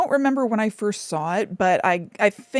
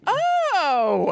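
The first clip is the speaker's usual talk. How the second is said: surprised; 10 semitones higher than usual. Real, not acted. Surprised by an unexpected treat.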